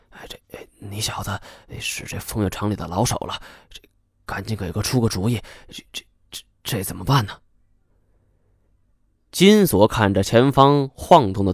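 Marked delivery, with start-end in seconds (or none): 0.1-7.3 hushed whisper to a friend. A narrator's reading. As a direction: lean in and whisper the question. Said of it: "悄悄问".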